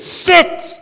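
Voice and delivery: loud, high referee call; imitated referee command. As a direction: shout it out like a rugby ref. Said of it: "Then the ref says".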